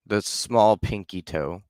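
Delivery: ordinary delivery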